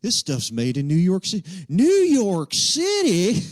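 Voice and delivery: cowboy's incredulous cry, climbing high and loud; mock cowboy disbelief, ending in a loud stretched holler; outraged commercial cowboy. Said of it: "It's always the Cowboys. It's like".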